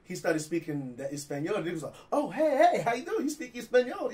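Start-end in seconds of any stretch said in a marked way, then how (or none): 2.1-4.1 mock friendly surprise. Customer discovering the clerk speaks Spanish, imitated surprised friendliness. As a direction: pitch it up, suddenly all friendly.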